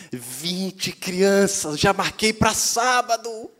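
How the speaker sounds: slow, stretched words climbing high and loud; thrilled announcement. Retold or imitated. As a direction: imitate a wife bursting with good news. Wife's thrilled news acted out.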